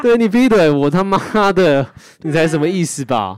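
high, loud furious rant; furious venting. Real, not acted. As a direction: rage at the cheating ex. Venting at cheating ex. (气愤地大声骂)

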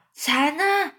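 high-pitched excited exclaiming; calling out excitedly. A narrator's reading. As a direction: cry out the discovery in a high voice. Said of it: "绿色老蚱蜢就大叫".

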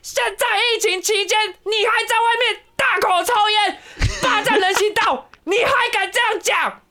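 hammed-up high-pitched scolding, slow and drawn out; crazy woman yelling. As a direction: squeal it way up like the unhinged lady scolding. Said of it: "然后就说".

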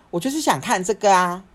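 high, loud stubborn insistence; insistent mom. Retold or imitated. Mom refusing to give up on the lotion, loud insistence. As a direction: dig in like a stubborn mother.

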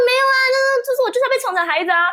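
high, whiny spoiled brat; spoiled princess whine. Retold or imitated. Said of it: "越来越成为一个公主病".

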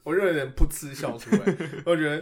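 loud giggling through the words; giggling as you confess; snorting laughs. Real, not acted.

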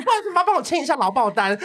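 laughing mock request. Joking mock reporter asking signature.

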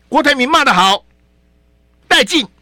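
high, loud hammed-up cheer; hammed-up fan applauding the insult; mock cheering.

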